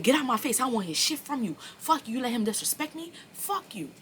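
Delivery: a replayed furious telling-off; retold angry yell. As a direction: snap at him in anger. Retold argument, cursing him out.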